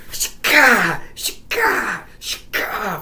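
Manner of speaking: imitated stab sounds, harsh bursts; vocal slashing effect, repeated three times; stabbing noises. Killer striking the kid, imitated stabbing noise.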